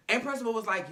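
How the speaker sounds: normal talking voice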